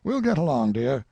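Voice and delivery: put-on old colonel sizing up a face, mildly excited; lightly voiced old colonel. A narrator's reading. Colonel to Tracy about a portrait, lightly voiced old man. Father waves her off.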